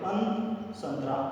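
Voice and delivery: अन then संतरा, both quiet and low, with the nose sound held; teacher breaking संतरा down for the class. Shown as a demonstration. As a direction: isolate अन, then say संतरा softly. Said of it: "जब ध्वनि नाक से निकलता है".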